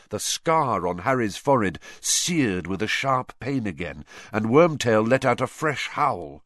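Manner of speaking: plain reading voice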